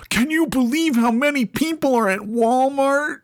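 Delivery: high, whiny mock outrage. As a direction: sneer it like a shocked busybody. Mocking complainers on Reddit.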